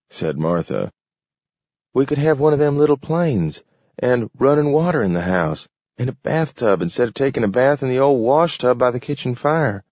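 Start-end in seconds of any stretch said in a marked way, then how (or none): 1.9-9.8 higher, quicker, eager farm wife; a wife tempting her husband with dreams, higher and fast; hopeful wife. A narrator's reading. Martha dreams aloud.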